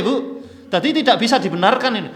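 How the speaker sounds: ordinary delivery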